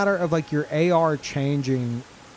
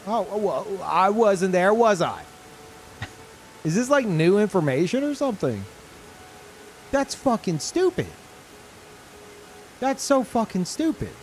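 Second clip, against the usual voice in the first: ordinary delivery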